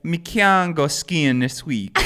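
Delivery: Jamaican patois breaking into laughter; Jamaican accent. Pretend. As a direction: laugh through a high, slow Jamaican accent. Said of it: "I say that I do that accent".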